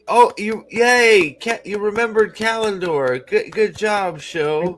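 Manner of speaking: high, loud mock cheering; patronising pat on the head; sarcastic praise. Mocking the show's writers with sarcastic congratulation, high mock cheer. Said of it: "be like".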